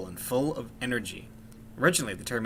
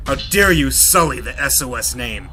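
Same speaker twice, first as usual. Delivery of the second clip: angry shout; 3 semitones higher, 8 dB louder than usual. Acted. Leader punches his men.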